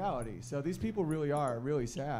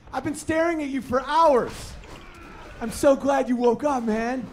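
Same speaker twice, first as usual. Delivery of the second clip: high, loud, slow gushing dog; excited dog voice; 10 semitones higher, 10 dB louder, slower than usual. Retold or imitated. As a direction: gush like a dog whose owner just woke up.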